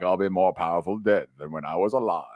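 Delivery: low, put-on wise mentor voice; Obi-Wan. Dying mentor promising power from beyond, low and solemn. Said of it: "we're shooting for an Obi-Wan".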